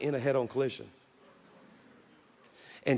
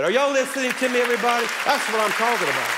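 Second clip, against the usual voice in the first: high and loud, rousing the crowd; loud, high preacher's call to the congregation; 8 semitones higher, 5 dB louder, faster than usual. Real, not acted. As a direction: shout it to the whole church.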